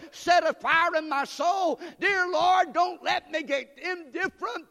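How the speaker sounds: loud, high, impassioned prayer shouted to God; preaching at full force. Acted. Preacher praying aloud.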